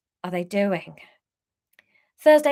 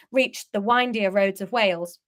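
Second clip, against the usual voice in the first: ordinary delivery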